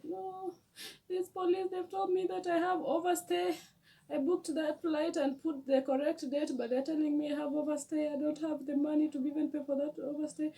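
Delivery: high, pleading voice through tears; tearful explaining. Retold or imitated. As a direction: plead your case while crying. Crying girl explains to stranger.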